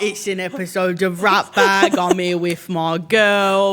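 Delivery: slow, drawn-out put-on London accent. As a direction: open the show as a hammed-up Londoner. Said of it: "you started doing this accent".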